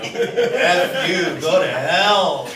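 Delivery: loud, high, slow scornful yell with a laugh; ex-wife's angry retort. Retold or imitated. Ex-wife yells back as police take him away, loud and mocking. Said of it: "she was just like".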